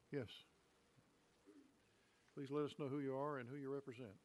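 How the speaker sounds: normal talking voice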